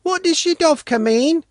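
put-on high questioning girl's voice; innocent girl. A narrator's reading. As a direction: ask it naively.

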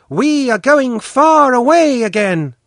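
put-on high, loud girl calling a farewell; ringing voice. A narrator's reading. Svetlana announcing they are going far away, ringing and high. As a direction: ring it out across the distance. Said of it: "in a ringing voice".

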